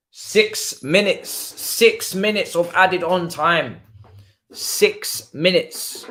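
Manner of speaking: high, very slow announcement, word by word; emphatic amazement. Real, not acted. Fan learning of added time, slow emphatic amazement. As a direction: stretch each word out high and strong.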